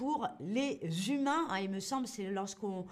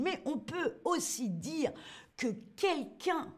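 ordinary talk

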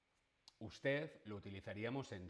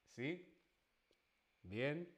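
usual speaking voice

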